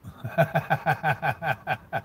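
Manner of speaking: long, steady run of chuckles. Real, not acted. Streamer amused by a chat message, long rolling chuckle. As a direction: keep chuckling, evenly pulsed.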